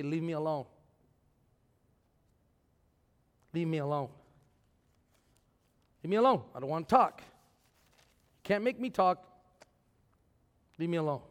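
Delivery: mock sulk. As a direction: grumble like a sulky kid refusing to talk. Voicing a sulking believer.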